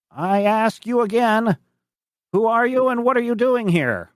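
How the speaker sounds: put-on high, insistent old farmer; high-pitched farmer challenging a stranger; old French farmer. Game master voices old farmer.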